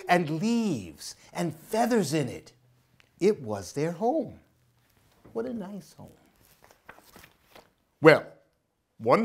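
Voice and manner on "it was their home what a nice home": ordinary delivery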